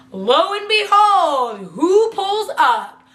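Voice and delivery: slow, high, drawn-out suspense; dramatic reveal. Real, not acted. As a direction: stretch each word for the big reveal.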